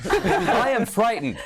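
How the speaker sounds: slow, high, laughing declaim; stagey mock fright. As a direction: act scared in a hammy way.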